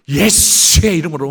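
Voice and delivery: loud, high prophetic proclamation; mock prophet. Pastor mocking showy prophets praying over people, loud. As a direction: bellow it like an overbearing prophet. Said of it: "너무 선지자처럼".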